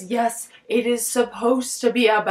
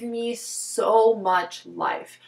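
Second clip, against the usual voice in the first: stretching the word so slowly with delight; me ssso much life, with the so held long; drawn-out so; 2 semitones lower, much slower than usual. Real, not acted. Fan gushing about the book's references, drawn-out so. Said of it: "gushing over references".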